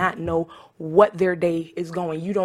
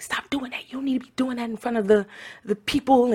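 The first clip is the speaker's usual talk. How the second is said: scolding lady; 6 semitones higher than usual. Retold or imitated. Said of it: "the lady was like".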